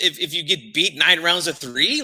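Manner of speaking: usual speaking voice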